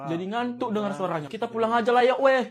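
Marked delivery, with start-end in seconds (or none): none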